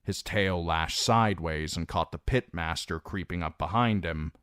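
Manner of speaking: ordinary delivery, nothing marked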